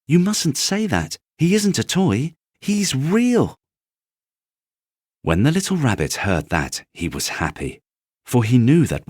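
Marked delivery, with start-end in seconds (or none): 0.1-3.6 put-on high, earnest child's voice; a small boy standing up for his toy; boy insisting. Boy insists rabbit is real.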